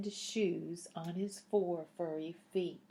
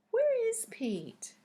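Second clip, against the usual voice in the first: much higher and louder, playful; teasing the children to guess; excited quiz question; 6 dB louder than usual. Acted. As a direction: ask the kids with big delight.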